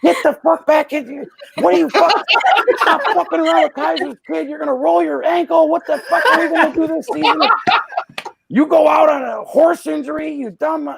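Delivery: high-pitched furious coach tirade; imitating an irate manager's high-pitched rant; Lou Piniella yelling. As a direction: yell at them at the top of your range. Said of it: "Lou Piniella comes out yelling at us".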